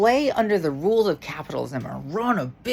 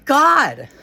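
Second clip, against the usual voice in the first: exasperated outburst; 6 semitones higher, 6 dB louder than usual. Real, not acted.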